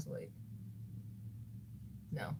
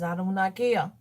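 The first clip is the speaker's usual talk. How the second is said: normal talking voice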